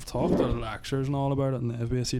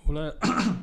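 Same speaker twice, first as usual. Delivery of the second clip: voice cracking; 5 semitones higher than usual. Real, not acted. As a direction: voice cracks with emotion. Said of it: "Jesus, my voice cracked".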